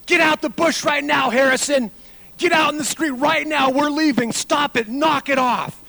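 loud, high furious dad yell; angry yell. Retold or imitated. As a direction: bellow orders at a misbehaving kid. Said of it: "all we hear him yell".

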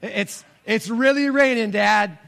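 "really raining" pushed out high and loud; a daughter pleading loudly on the phone; urgent daughter. Retold or imitated. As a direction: daughter stuck in the rain, calling dad to come, raising her voice. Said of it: "She says".

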